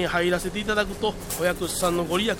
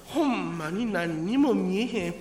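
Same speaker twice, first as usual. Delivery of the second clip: ordinary talk, nothing marked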